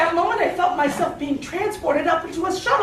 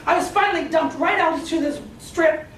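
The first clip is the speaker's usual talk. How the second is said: ordinary delivery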